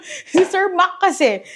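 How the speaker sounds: high-pitched, giggly blame; laughing. Real, not acted. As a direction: say it through real laughter. Laughs at copying Sir Mac. (natatawang paninisi)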